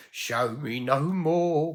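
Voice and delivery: loud, high, slow anguished plea; anguished cry. Acted. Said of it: "cried Ebenana".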